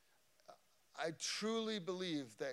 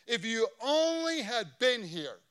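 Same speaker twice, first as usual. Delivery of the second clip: loud, high-pitched anguished plea; Martha's reproach; 9 semitones higher, 14 dB louder than usual. Acted. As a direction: cry out to the Lord, high and loud. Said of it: "She says".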